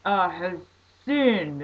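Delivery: slow, loud preacher call; mock preacher asking who has sinned; preacher's call. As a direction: boom it out like a street preacher. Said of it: "the guy says".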